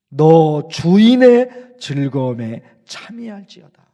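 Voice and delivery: bestow the praise like the master returning; voice of God praising. Acted. Preacher voicing God praising the faithful servant, slow and solemn. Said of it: "그 다음 말씀 기억나시죠?".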